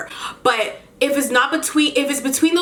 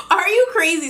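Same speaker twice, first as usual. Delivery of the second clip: high-pitched mock disbelief; incredulous; 6 semitones higher than usual. Woman retelling her retort to the neighbor, incredulous. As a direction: replay the retort in a raised, amused pitch.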